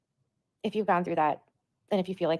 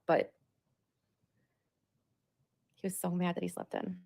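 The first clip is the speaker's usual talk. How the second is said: usual speaking voice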